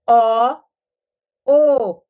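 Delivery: O first open "ó", then closed "ô". Shown as a demonstration. Teacher contrasting O vowels for literacy trainees. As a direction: contrast the two O sounds slowly. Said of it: "É diferente".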